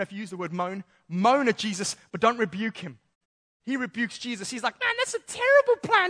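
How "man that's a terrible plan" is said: high put-on dismissive voice; mocking Peter. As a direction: scoff at the plan. Peter rebukes Jesus, mocking voice.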